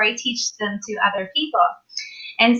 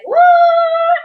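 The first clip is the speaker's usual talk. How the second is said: very high, loud drawn-out whoop; astonished; 6 dB louder than usual. Retold or imitated. Woman realizing her mother was crossing over, astonished squeal. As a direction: squeal it out in amazement.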